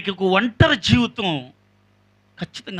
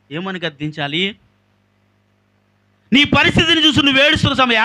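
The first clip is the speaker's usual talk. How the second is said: ordinary talk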